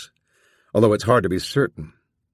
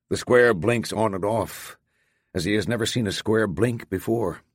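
ordinary delivery

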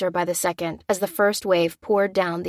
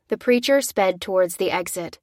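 usual speaking voice

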